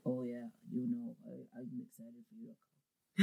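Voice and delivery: very quiet, low and slow mumble; terse unenthusiastic imitation. Friend imitating online scammer's short sentences, quiet and halting. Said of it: "he would speak in short sentences".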